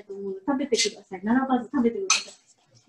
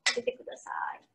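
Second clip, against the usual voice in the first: whispered aside; 9 dB quieter, faster than usual. Real, not acted. Quiet aside during meal.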